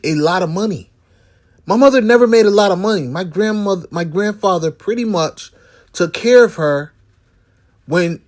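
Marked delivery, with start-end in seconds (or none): 1.7-3.0 fast emphatic repeat. Real, not acted. Repeating to stress mother never earned much, fast. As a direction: hammer the point home quickly. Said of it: "but my mother never made".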